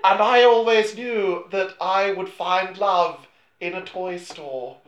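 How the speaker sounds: very slow, laughing bad British accent. Podcaster mimics the film's bad British accent, laughing. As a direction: mangle a British accent on purpose, giggling. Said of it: "a bad impression of her own bad British accent".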